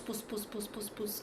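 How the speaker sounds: hushed pss-pss of gossip; imitated village whispering. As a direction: whisper the gossip sounds very softly.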